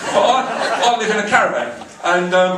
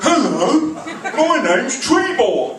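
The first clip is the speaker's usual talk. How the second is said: dim officer; 6 semitones higher, much slower than usual. Retold or imitated. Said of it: "He went".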